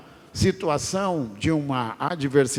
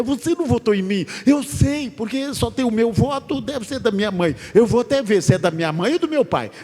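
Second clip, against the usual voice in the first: higher and louder, fast indignant scolding; mock quarrel; 9 semitones higher, 4 dB louder than usual. Candidate berating his wife for not voting, hammed-up higher angry rant. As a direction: scold the wife, pitched up and rushed. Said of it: "E brigando com a mulher".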